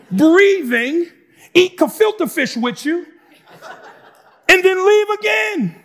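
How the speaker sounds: excited loud paraphrase. Retold or imitated. Preacher voicing Jesus taunting grumbling disciples, loud and cocky. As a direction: shout it like a cocky challenge, y'all worried about that. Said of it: "Let me give you the emotional tone of this text".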